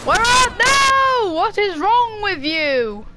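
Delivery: high, drawn-out cry of protest; exasperated shout. Real, not acted. Player asks what is wrong with you, exasperated cry. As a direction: wail slowly and high at the attacker.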